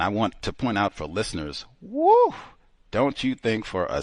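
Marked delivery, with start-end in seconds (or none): 1.8-2.3 short, loud high "woo"; high whoop. Real, not acted. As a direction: let out a sudden high whoop. Exasperated host interjects mid-point.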